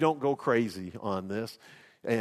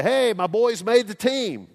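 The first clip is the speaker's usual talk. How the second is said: proud boast; 9 semitones higher, 7 dB louder, slower than usual. Pretend. Imagined proud boast to restaurant.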